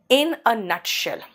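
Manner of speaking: in a nutshell, drawn out slowly; teacher slowing the idiom for students; slow model phrase. Shown as a demonstration. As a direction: spell it out word by word. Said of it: "तो क्या कहोगे".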